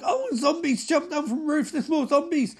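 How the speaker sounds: high-pitched, excited burst; hammed-up panicky commentary; mock alarm. Mock alarm at sudden zombie attack.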